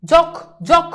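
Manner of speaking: যখ said "jok", cut short, twice with pauses; demonstrated syllable. Shown as a demonstration. Teacher showing students the first syllable, যখ as short "jok". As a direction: give যখ alone as a short "jok". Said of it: "এখন উচ্চারণটা কি".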